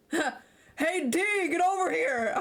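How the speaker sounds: high, strained character call; painful put-on voice. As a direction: call someone over in a voice that hurts your throat. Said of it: "Oh my god, that voice. It hurts".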